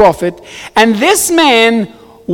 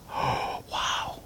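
whispered, retold amazement; hushed wow; 20 dB quieter than usual. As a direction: let it out as a voiceless breath. Retold hushed reaction to her answer.

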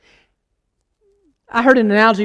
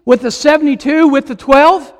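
raised-pitch, emphatic sermon delivery; preaching; 5 semitones higher, 7 dB louder than usual. Acted. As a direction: preach it with force, stretching key words. Preaches at full force.

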